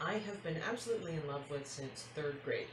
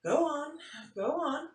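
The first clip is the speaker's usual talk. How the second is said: eager mom; 11 semitones higher, 6 dB louder than usual. Acted. Mom eager for more story.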